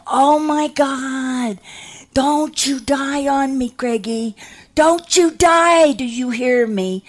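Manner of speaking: high, drawn-out desperate cry; hammed-up panicked wail; frantic pleading. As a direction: plead with the dying not to go. Acted pleading with dying boy.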